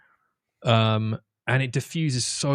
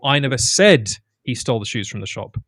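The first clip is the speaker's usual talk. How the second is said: "said" pitched up and loud mid-sentence; stress on SAID; 4 dB louder than usual. Shown as a demonstration. Demonstrating emphasis to a podcast co-host, stress on "said". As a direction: lean hard on the word "said". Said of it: "they would emphasize different words".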